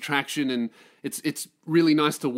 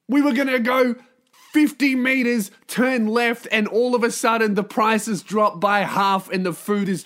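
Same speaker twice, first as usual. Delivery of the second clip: high, loud pleading rant; exasperated protest; 5 semitones higher, 4 dB louder than usual. Acted. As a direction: argue your case in mock outrage. Boyfriend keeps protesting his plan.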